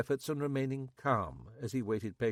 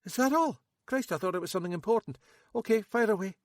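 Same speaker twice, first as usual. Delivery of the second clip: higher, louder nervous local man; Max; 10 semitones higher, 5 dB louder, faster than usual. A narrator's reading. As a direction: play Max high and jittery. Max voiced.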